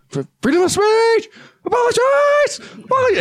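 very high, strained shouted slogans; retold yell. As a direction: yell the slogans while being pushed out. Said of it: "And so I yelled".

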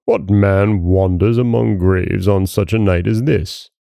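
ordinary delivery

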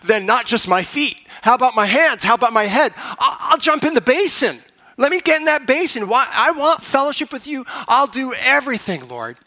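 preacher hamming up an overeager Peter; eager Peter. Retold or imitated. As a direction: throw yourself in like all-or-nothing Peter. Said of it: "in Peter fashion".

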